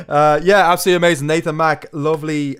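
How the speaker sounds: loud, high gushing praise; excited. Real, not acted. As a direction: burst out with enthusiasm. Right after laughing.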